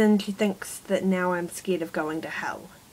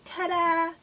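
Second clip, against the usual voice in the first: higher, bright showman flourish; jokey ta-da; 9 semitones higher than usual. Retold or imitated. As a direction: present it like a magic trick. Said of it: "it's like a jokey mood".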